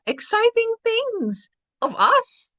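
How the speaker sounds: squeaky high voice. Real, not acted. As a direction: jump way up in pitch.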